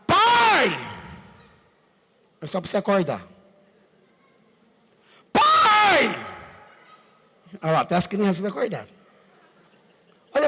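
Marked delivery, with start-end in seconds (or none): 0.1-0.8 loud, high call of "Pai!"; child's cry for dad. Retold or imitated. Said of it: "ele gritar". 5.3-6.2 loud, high call of "Pai!"; child's cry for dad. Retold or imitated. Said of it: "ele gritar".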